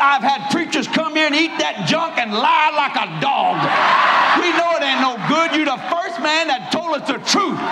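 husband praising the honest preacher; husband confessing. Retold or imitated. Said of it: "That man said".

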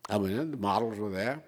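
usual speaking voice

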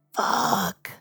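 unvoiced whispered swear; whispered curse. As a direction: hiss it under your breath. Sudden realization, under breath.

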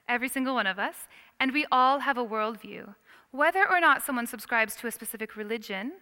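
usual speaking voice

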